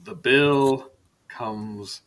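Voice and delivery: very slow, ominous line, dropping away at the end; ominous movie quote. Acted. Game master quotes a film line to the players, ominous and drawn out.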